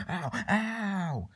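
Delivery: high, play-acted yelps of pain; pained cries. Hurt in a line scuffle, yelping. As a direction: yelp as if being hit.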